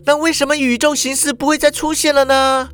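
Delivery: put-on high girl's voice, plain question; high voice, stress on 再; Shuhua asking. A narrator's reading. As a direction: ask it curiously. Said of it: "淑华就又问了".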